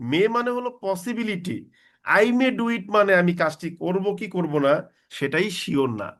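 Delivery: ordinary talk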